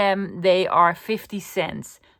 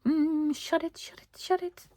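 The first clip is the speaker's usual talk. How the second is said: hushing herself; 9 semitones higher, 6 dB quieter than usual. Retold or imitated. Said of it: "I was almost screaming".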